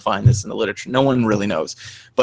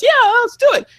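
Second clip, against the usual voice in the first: put-on much higher, louder eager kid; little kid voice; 6 dB louder, faster than usual. Uncle imitating the four-year-old nephew, high and eager. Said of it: "the little four-year-old says".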